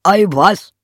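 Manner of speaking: high, loud exclamation; donkey character. Acted. Donkey dismisses the rooster's woe, high loud exclamation. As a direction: brush it off at full voice. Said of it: "sagte der Esel".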